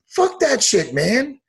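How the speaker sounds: high-pitched, indignant outburst; worked-up rant. Real, not acted. Fighter telling off a coach for chasing attention, worked up. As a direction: pitch jumps up in exasperation.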